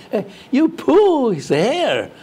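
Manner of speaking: high, slow English question, hammed up; mock British reporter. British TV reporter grilling the consul, mock high and slow. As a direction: put on a prying TV reporter voice. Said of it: "英国电视问说".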